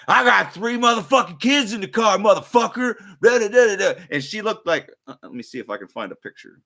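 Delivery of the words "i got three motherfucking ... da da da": imitated angry woman. The one-toothed lady yelling at him, imitated ranting. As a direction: ham up the yelling mother. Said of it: "she was like".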